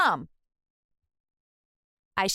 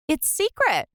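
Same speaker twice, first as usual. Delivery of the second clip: secretive reply; 9 semitones higher than usual. A narrator's reading. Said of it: "I replied".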